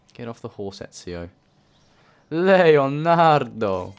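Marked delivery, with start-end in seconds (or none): 2.3-3.9 long drawn-out call across the street. Real, not acted. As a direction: shout his name, stretching it slowly. Calling the character in game.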